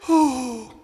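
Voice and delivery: high, hooty, loosely held oh; light loose "oh". Shown as a demonstration. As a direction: let a high oh fly out loosely. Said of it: "go even lighter and loose".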